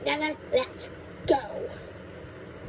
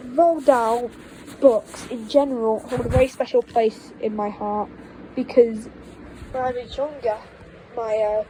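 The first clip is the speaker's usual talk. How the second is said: ordinary delivery, nothing marked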